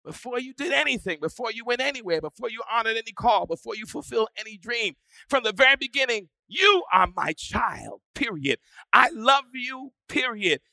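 loud, rising preacher's cry; full-force preaching. Acted. Pastor preaching loudly to his congregation.

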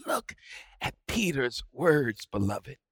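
ordinary delivery

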